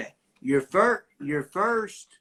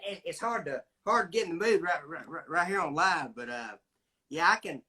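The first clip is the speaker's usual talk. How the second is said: ordinary talk, nothing marked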